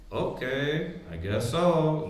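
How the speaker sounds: a hint of an eerie, hollow voice; spooky reply. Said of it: "Then you get real spooky on them".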